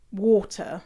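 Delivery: "water" as WAW-tuh with a crisp T; posh British water. Shown as a demonstration. How posh British people say it, crisp-T "water". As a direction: sound the T in water. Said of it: "posh British people say".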